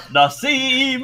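half-shouted call to a steed; mild shout. Acted. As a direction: summon your horse with a rising call. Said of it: "And just shouts".

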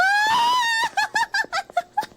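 high scream into laughter. Real, not acted. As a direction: shriek then laugh. Screams at jump scare.

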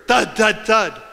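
rapid, forceful imitated thuds. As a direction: fire off the thuds in quick hard hits.

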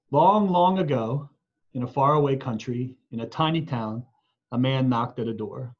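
gentle, expressive picture-book narration; reading to children. Acted. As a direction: read it like a bedtime picture book for little ones. Reads picture book aloud to families.

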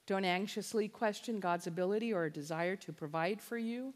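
ordinary talk, nothing marked

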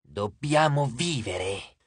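a slow, soft, possessed hiss. A narrator's reading. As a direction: hiss it out softly, dragging each word. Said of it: "Leo sibilò piano".

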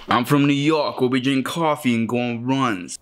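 playful mock New York accent. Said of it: "Working on my New York accent".

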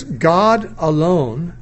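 GOD ALONE drawn out very slowly; "alone" said slowly and emphatically; emphatic ALONE. Shown as a demonstration. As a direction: stretch "God alone", weighting each word. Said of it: "the word alone is emphatic".

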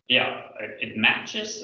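ordinary delivery

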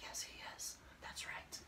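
hushed, voiceless play-acted gossip; children whispering. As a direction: pretend gossip in a very soft whisper. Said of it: "The children are whispering to each other".

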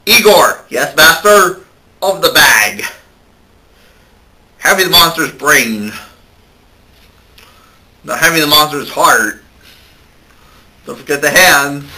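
commanding mad doctor; mad scientist. Acted. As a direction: bark orders at your servant. Frankenstein commands servant.

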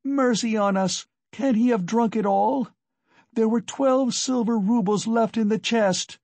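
high-pitched, appalled outcry; wife wailing over the missing money; frantic cry. A narrator's reading. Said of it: "she cried".

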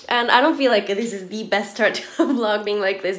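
usual speaking voice

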